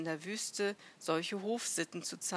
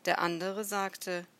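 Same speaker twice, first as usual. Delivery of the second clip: ordinary delivery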